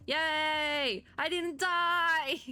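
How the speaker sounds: high, drawn-out triumphant cheer; relieved cheer. Real, not acted. Player who just survived a spider fight, cheering. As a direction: celebrate surviving, stretching the words out high.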